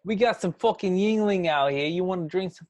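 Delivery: hammed-up New York tough talk; mock New York accent. Friend mocking the New York lady's offer of help, mild New York accent. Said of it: "that's not a New York accent".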